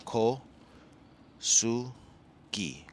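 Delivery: "Ko Sugi" broken into separate syllables; "Ko Sugi" with long gaps between syllables; sounding out. Shown as a demonstration. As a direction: read "Ko Sugi" piece by piece from the spelling, hard G. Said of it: "and then K O S U G I".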